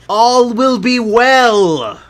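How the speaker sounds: high, drawn-out shout; burgomaster's cry. A narrator's reading. Burgomaster proclaiming to the townsfolk in a downpour, high loud cry. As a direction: cry it out loudly, stretching every word. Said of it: "cries the burgomaster".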